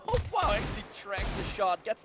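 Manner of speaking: sudden high-pitched excited burst. Real, not acted. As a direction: yelp with delight at the shot. Caster reacts to a great shot.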